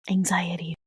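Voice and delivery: voiceless whisper; whispered aside. Real, not acted. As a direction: drop to a whisper for the word. Naming her worry as an aside.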